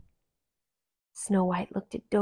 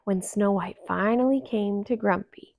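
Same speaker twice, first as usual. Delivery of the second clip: usual speaking voice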